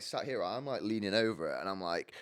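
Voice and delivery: ordinary talk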